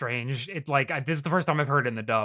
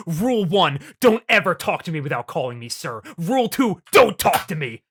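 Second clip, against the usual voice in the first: bossy retold command, louder on each rule; imitated stern orders; 2 semitones higher, 5 dB louder than usual. Ken laying down rules to Gomamon, imitated bossy bark. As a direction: lay down the rules forcefully, mock-menacing. Acting out Ken's bullying orders.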